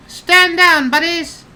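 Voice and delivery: very high, loud bugbear yell; yelled order. Acted. Lady Bugbear to the other goblins, high loud yell. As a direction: holler a command in a high put-on voice. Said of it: "she yells in Goblin".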